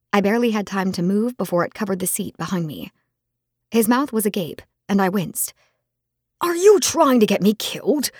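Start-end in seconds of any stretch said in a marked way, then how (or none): 6.4-8.1 higher, louder startled outburst; exclaimed. Acted. Friend spluttering at her shocking request, loud exclaim. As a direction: blurt it out in alarm. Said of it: "he exclaimed".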